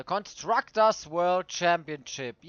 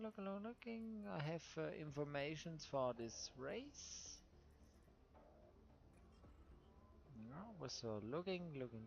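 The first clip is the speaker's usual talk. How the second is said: low mumble while searching the screen; half-voiced muttering, mind elsewhere; muttering to himself; 3 semitones lower, 22 dB quieter than usual. Real, not acted. Searching info, talking to himself.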